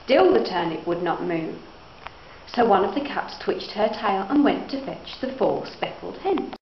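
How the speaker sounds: usual speaking voice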